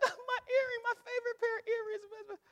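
high, whiny lament over lost earrings; mock-tearful wife mourning her favourite pair; wailing wife. Preacher imitates wife mourning her earrings, high and whiny. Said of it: "so she's like".

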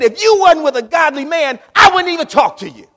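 prophet's scornful shout; blunt prophet. Acted. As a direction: throw it at the king with scorn. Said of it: "He said".

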